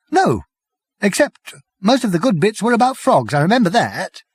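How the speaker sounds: high, light and giddy; merry little man; high Prack voice. A narrator's reading. Prack to Arthur, high and giddy.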